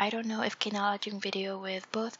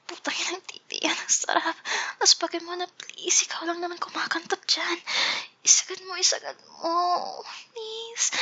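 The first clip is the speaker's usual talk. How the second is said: high, breathy lewd moaning; seductive moaning; 8 semitones higher than usual. Pretend. Reading lewd lines in character.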